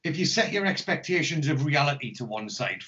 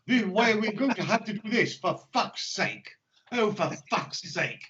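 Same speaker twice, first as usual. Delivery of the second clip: mock posh English accent, pitched up; 6 semitones higher than usual. Imitating the posh English accent of the young gang boss. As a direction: put on a plummy posh English accent for the gangster. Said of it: "he speaks with a very posh accent".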